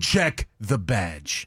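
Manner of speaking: slow, unbothered mock fed; very calm. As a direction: say it slowly, with calm authority. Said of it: "they're always very calm".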